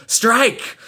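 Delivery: sharp order to strike; loud command. Acted. Knight ordering the maid to stab, loud command. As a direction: bark it high and loud. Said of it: "demanded the knight".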